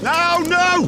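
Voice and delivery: high, loud alarmed no; dismayed cry. Real, not acted. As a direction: wail at the car failing. Car breaks down.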